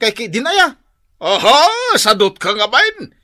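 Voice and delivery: loud, high, slow berating; angry berating. Acted. Father berating his shameless lazy son. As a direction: drag out the insult in anger.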